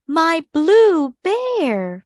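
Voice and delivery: put-on high, slow, dismayed cry of a little girl. Clementine finds the lion hugging her bear, high dismayed cry. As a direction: stretch it out in shocked dismay.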